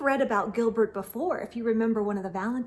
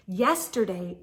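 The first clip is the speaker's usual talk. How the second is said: plain reading voice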